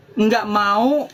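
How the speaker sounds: ordinary talk, nothing marked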